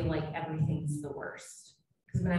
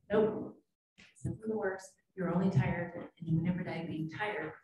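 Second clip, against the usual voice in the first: ordinary talk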